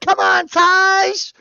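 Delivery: a drawn-out stan cheer; excited cheer. Real, not acted. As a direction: egg her on like a fan at a show. Cheering on [name].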